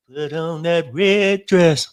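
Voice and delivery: teasing friend voices a playful order; mock command. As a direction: ham it up like a sultry demand. Mocking [name] at barbecue.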